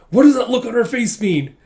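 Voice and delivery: high, loud mock panic; freaking out. Brother sees his sister's pleased look, freaking out. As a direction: demand it in a hammed-up freak-out. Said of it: "you see Yuri freaking out with".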